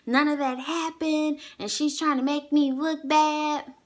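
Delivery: high, drawn-out mock complaint; whining to mom. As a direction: imitate a son protesting to his mother. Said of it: "he talking to his mom".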